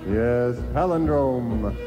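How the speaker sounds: ordinary delivery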